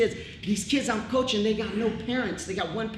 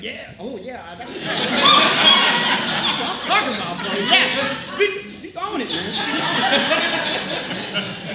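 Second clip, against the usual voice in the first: laughing hype; 4 semitones higher, 7 dB louder, much slower than usual. Retold or imitated. Imitating friend's hyped agreement.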